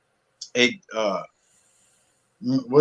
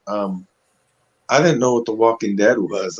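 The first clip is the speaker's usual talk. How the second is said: ordinary talk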